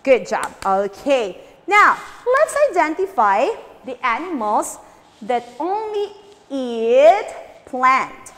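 ordinary delivery

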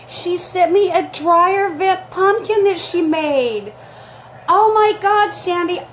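excited. Real, not acted. Host showing the viewers the handmade pumpkin, excited. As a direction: announce the handmade gift with glee. Describing gift.

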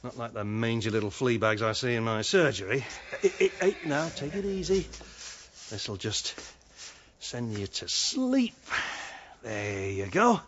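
low, gentle murmur to an animal; soothing a horse. Acted. Vet calming a horse before sedation, gentle and low.

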